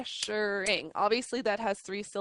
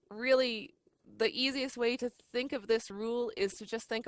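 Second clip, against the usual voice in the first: ordinary talk, nothing marked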